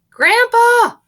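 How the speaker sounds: very high, loud excited cry; boy crying out. Acted. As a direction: call out to grandpa. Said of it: "cried Charlie".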